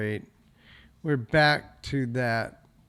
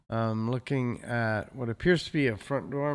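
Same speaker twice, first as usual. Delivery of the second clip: ordinary talk, nothing marked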